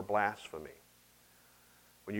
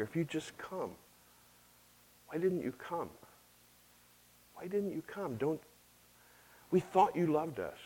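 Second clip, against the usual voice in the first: hushed, broken lament, voice nearly gone; softer, pleading and hurt, as if holding back tears; grieving reproach; 2 semitones higher, 5 dB quieter than usual. Acted. Mary to Jesus after her brother died, softer grieving plea. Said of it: "same thing Martha said".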